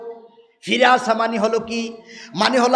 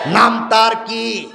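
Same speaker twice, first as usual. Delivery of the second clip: ordinary delivery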